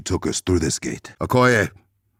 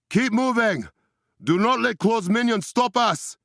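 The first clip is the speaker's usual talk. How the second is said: shouting commands. Acted. Battle commands to allies.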